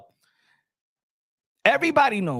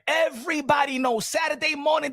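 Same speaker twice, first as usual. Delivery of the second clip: usual speaking voice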